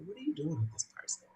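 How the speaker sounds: hushed, quick retold question; discreet aside. Someone pulling her aside on a date, discreet aside. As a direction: drop the voice for a private word. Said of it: "pulled me to the side and said".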